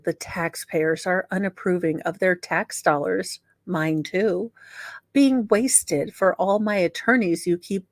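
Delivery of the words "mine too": usual speaking voice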